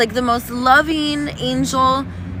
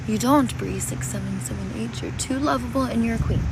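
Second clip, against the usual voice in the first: normal talking voice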